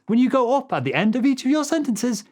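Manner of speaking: high pitch throughout, lifting "sentences" like a question; questioning uptalk. Shown as a demonstration. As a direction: go up on "sentences" as if unsure. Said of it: "it sounds like you're asking a question".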